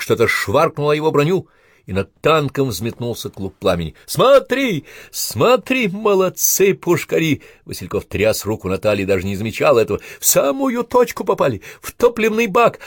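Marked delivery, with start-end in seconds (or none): 4.0-7.4 very high, loud cheer; thrilled, ringing battle cheer; jubilant shout. Acted. Soldier rejoices at tank being hit. 10.2-12.8 very high, loud excitement; elated high shout; jubilant. Acted. Soldier rejoices at direct hit.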